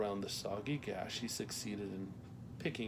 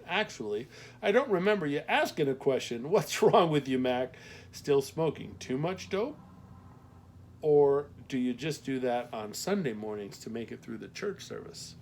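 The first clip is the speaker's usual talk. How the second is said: teasing Midwestern postmaster, louder; Midwestern accent; 4 semitones higher, 6 dB louder than usual. A narrator's reading. Postmaster jokes about Mac smoking dope, teasing Midwestern accent. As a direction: rib him in a light Midwestern voice. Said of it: "her Midwestern accent left no doubt".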